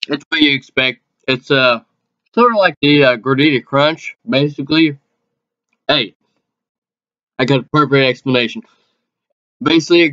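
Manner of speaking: food in cheek; talking while chewing. Real, not acted. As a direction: mouth partly full. Seen 1: Left cheek bulges while talking; was eating just before.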